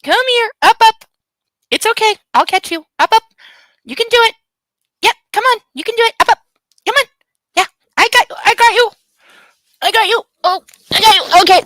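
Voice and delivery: high, sing-song pet talk; coaxing the puppy. Real, not acted. Owner coaxes her puppy to jump into her arms, high and sweet. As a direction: coax a nervous puppy up into your arms.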